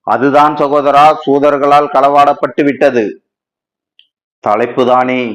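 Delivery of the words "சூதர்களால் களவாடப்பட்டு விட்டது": normal talking voice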